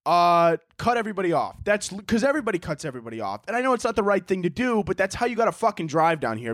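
ordinary talk, nothing marked

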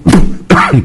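sudden hard cough; coughing fit. Real, not acted. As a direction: cough breaks into the sentence. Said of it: "tosse pega a gente". (acesso de tosse)

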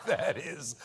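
low, slow Schumer imitation; Schumer impression. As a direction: drone it like an old senator. Mimicking Schumer Yiddish ad.

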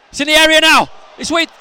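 high, loud live match call; urgent, rising-stakes commentary; excited commentary. Real, not acted. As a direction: shout the build-up as the chance grows. Live attack near goal.

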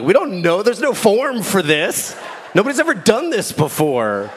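high-pitched, flustered mock protest; baffled exasperation. Gas station managers to driver, flustered exasperation. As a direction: throw up your hands in bafflement.

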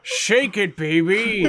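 cocky action-hero catchphrase; lightly voiced swaggering tough guy; Duke voice. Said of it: "a different Duke".

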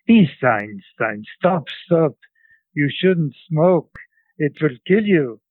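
put-on scolding old woman, thick Central European accent, pitch rising; grandmother's Central European accent. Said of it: "imitate my grandmother, uh, my grandmother's, uh, English".

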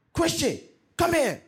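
sharp, high, loud call; stern summons. Retold or imitated. Furious grandfather summoning the grandson, loud.

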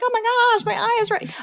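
high, alarmed client call the next day. Retold or imitated. Lash tech imitating a panicked client calling back, high and alarmed. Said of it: "the next day they'd call me like".

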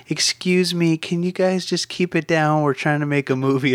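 high, polite retold request; asking nicely. Crew member asks noisy girls to keep it down, asking nicely. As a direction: imitate a courteous plea in a lifted voice. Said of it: "Michael had asked nicely".

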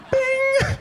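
very high sung chime; ping it like the flight attendant button; call button ding. Retold or imitated. Passenger pressing the call button, a high chime.

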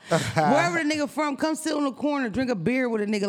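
ordinary talk, nothing marked

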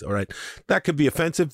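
ordinary talk, nothing marked

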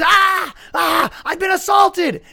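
loud, very high hammed-up wailing; mock scream. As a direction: imitate a punched lady crying out, slow and high. Punched lady cries out, retold.

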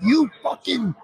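high-pitched, drawn-out mock rant at the TV; retold angry yelling. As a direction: imitate yourself cursing out the TV, slowly. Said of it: "Yelling at my T V".

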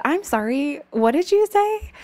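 high, loud, mock-shocked question; incredulous nun. Nun challenges pupil's pronunciation.

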